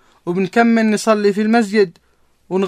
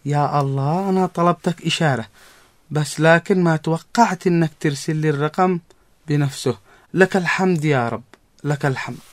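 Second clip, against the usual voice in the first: prayer; 6 semitones lower, 3 dB quieter than usual. Acted. Grateful prayer to God.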